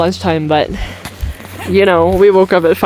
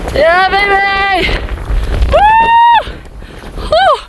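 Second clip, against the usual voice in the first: high whooping cheer; excited cheer; 10 semitones higher, slower than usual. Real, not acted. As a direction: whoop with joy at the top. Cheering at the summit.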